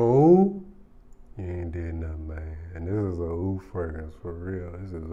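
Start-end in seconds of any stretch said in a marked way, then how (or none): 0.0-0.6 imitated ooh reaction.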